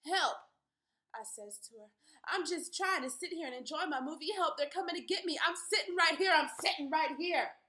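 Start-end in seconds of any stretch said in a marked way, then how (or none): 0.0-0.4 loud, high call for help; cry for help. Acted. Calling to screen for help. 2.2-7.6 loud, high, frantic pleading; desperate pleading shout. Acted. Pleading as they come for her.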